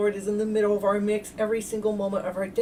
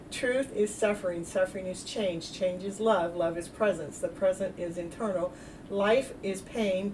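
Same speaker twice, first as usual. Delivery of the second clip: soft, lower recited chain; solemn mantra; 3 semitones lower, 4 dB quieter than usual. Acted. Healer reciting a viewer's mystical chain, soft and solemn.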